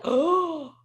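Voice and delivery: gasping, high and loud mock disbelief; shocked listeners. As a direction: imitate a scandalized young audience. Imitates shocked young audiences.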